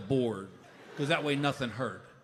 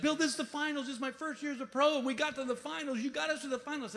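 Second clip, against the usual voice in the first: high-pitched imitation of an excited partner; giddy; 12 semitones higher than usual. Young pro to her partner about the finals, mock giddy. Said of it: "Emma is out of her mind giddy".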